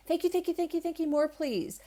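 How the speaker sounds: high, run-on chant of thanks; eager gratitude. Acted. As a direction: rattle off thanks, pitched high.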